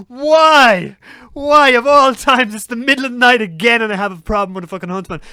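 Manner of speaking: loud, high cry of despair; exasperated wail. Retold or imitated. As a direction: drag out the complaint, wailing at fate. Despair at spider inside the car.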